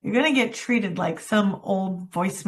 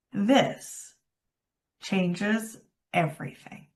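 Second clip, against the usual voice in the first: slow, landing it; 2 semitones lower, 4 dB quieter, slower than usual. Shown as a demonstration. Coach showing how slowness lands a phrase, soft. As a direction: let each word land slowly. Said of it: "slow down when you want it to land".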